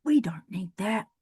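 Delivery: a hushed embarrassed whisper; sheepish whisper to herself; whispered aside. Real, not acted. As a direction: whisper it under your breath. Embarrassed about saying supple.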